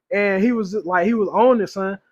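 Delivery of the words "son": usual speaking voice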